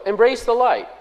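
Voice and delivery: ordinary delivery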